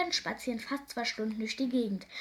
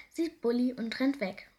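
ordinary delivery

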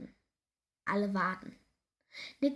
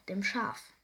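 ordinary delivery, nothing marked

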